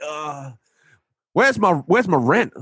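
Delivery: New York landlord wanting rent; New York accent. Retold or imitated. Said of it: "New York accent".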